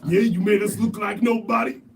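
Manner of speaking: loud, high taunting boast; hype shouting. Pretend. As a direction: taunt him like a fighter. Boastful hype mocking boxer.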